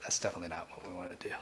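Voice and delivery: quick whispered aside to himself; fast whisper. Real, not acted. Streamer grumbling to himself about the audio, fast whisper. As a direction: rush it out under the breath.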